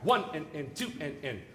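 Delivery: "One" and "two" stressed, each "and" lighter; accented downbeats. Shown as a demonstration. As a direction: lean on "One" and "two", let each "and" fall away. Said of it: "we have to accent the one and the two".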